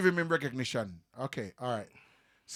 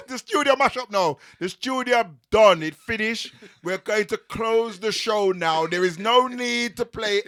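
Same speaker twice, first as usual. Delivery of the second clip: loud, higher, slowed declaration; hyped; 5 semitones higher, 6 dB louder than usual. Real, not acted. Guest hypes the show to a close, loud and high.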